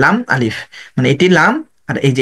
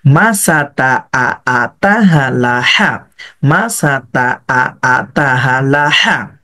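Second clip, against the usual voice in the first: very slow, syllables drawn out; slow joined drill; much slower than usual. Shown as a demonstration. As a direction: join the pieces slowly and evenly. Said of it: "আগের পড়াটার সাথে মিলিয়ে দেন". (আগের পড়ার সাথে ধীরে মিলিয়ে পড়া)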